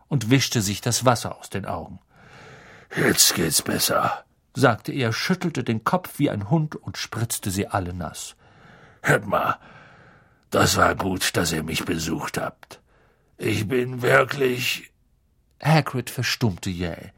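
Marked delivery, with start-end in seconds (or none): 2.9-4.2 low, slow big-man voice; deep, slow relief; recovering Hagrid. Acted. Hagrid returns after dunking head. 9.0-14.9 low, heavy big-man voice; deep, sincere gratitude; grateful Hagrid. Acted. Hagrid thanks his visitors.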